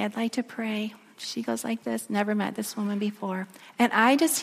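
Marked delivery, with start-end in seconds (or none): 0.0-0.9 softly spoken, close to her ear; a soft murmur, not a true whisper. Retold or imitated. Pastor telling the grieving woman he'll pray, softly. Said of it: "I just whisper in her ear".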